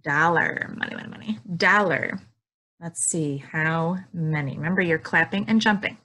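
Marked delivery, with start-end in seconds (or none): 0.8-1.4 playful whispered aside. Acted. Teacher whispers a joke to kids, playful. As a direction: whisper it teasingly.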